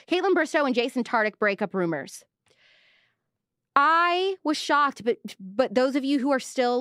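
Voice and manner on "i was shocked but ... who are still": exaggerated shock. Mock shocked reaction.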